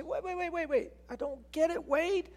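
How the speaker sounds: high, flustered objection; protesting listener. Retold or imitated. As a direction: sputter it out like a confused churchgoer. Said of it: "You say".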